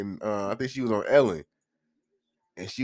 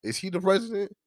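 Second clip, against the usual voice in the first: high, loud disbelieving comeback; high-pitched mock outrage; incredulous retort; 10 semitones higher, 4 dB louder than usual. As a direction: shoot it back like it's obvious nonsense. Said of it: "I'm like".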